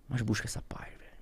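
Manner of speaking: whispered plea to the viewer; a whispered nudge; hushed advice. Real, not acted. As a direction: say it softly, urging.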